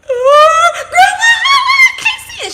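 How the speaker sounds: put-on very high, loud, crying-laughing child wail. Maya wailing to Grandma, very high loud cry. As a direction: wail it like a frantic little girl. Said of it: "Maya was like".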